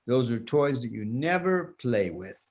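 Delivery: usual speaking voice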